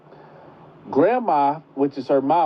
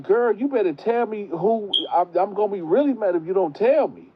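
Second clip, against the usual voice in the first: high, fast warning; grandma warning the girl to tell, high and fast; threatening grandma; 8 semitones higher than usual. Retold or imitated. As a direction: demand it, pitched up and quick. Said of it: "And she was like".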